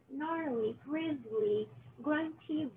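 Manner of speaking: plain reading voice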